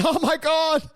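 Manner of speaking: high, frantic escaped android; high panicked runaway bot; escaped robot. Acted. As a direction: squeal it like a freed robot.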